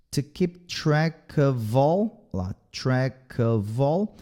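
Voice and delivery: "of all" linked slowly, its f voiced as a v; slow linking demo. Shown as a demonstration. Teacher slowly shows students how "of all" links. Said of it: "esse F aqui ... som de V".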